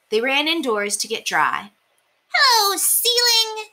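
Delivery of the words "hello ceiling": much higher, slow greeting; cheerful lanky mouse; tall mouse. A narrator's reading. Very Tall Mouse greeting friend, high and slow. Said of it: "said Very Tall Mouse".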